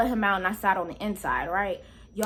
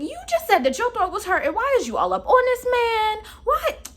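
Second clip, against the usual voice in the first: high, loud indignant questions; high, loud indignant scolding of the lady; 10 semitones higher, 4 dB louder than usual. Retold or imitated. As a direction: scold her in high disbelief.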